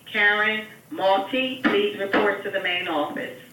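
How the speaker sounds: usual speaking voice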